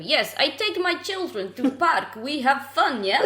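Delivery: put-on high, loud, slow caricature of an Eastern European accent; hammed-up Eastern European friend impression. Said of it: "we always do it really offensively".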